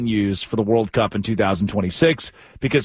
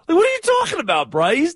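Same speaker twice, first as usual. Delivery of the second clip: high, fast disbelief; incredulous; faster than usual. Real, not acted. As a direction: pitch shoots up in disbelief. Incredulous rant at Bryce.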